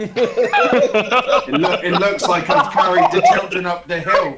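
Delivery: talking through helpless laughter; laughing. Real, not acted. Player realises his accidental heroism, laughing as he speaks.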